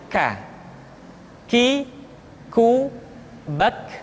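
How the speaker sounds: "K. Ki, ku, bak" as aspirated kaf syllables; "K. Ki, ku, bak" spoken apart, airy k; breath-flowing k. Shown as a demonstration. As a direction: let breath flow after each k in "K. Ki, ku, bak". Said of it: "nafas yang mengalir".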